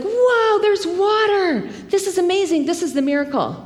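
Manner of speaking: high, wide-eyed whoa at the water; amazed child voice. Retold or imitated. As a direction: play a kid thrilled by a desert miracle. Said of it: "he was like".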